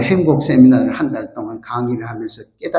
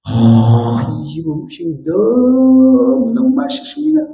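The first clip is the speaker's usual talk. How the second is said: gushing praise; 6 semitones higher, slower than usual. Retold or imitated. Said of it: "그래도요".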